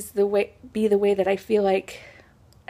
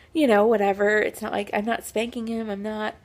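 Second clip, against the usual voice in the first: normal talking voice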